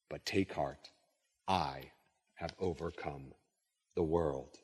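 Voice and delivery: low, measured Bible reading; solemn reading that grows hushed; scripture reading. Acted. Reads scripture aloud.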